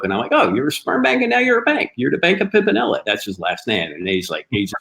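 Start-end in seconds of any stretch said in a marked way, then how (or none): 0.3-3.0 retold joke, pitched up and punchy; joking tease. Teasing his brother-in-law about money worries, jokingly. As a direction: deliver it higher, like a punchline ribbing someone. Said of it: "it was kind of a joke".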